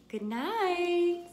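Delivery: high, sugary, innocent good night; said sweetly. A narrator's reading. Boys pretending to obey Father, sweetly sugary. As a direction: pour on the fake angelic sweetness. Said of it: "said the boys sweetly".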